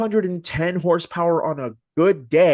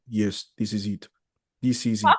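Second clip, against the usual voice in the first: lightly put-on Swedish accent, lower; a hint of a Swedish accent; 6 semitones lower, 3 dB quieter than usual. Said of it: "the most terrible Swedish accent".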